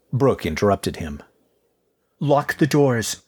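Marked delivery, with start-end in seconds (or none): 2.2-3.2 slow, firm command; ordering. Acted. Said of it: "she ordered".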